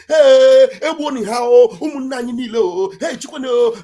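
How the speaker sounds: mock lament, calling out to kinsmen; hammed-up wailing cry. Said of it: "if I come out now and I'm crying".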